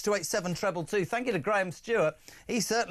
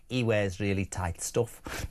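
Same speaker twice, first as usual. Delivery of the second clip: lower, slow mock Liverpool accent; 8 semitones lower, slower than usual. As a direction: draw it out, imitating a Liverpool woman. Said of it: "In that accent".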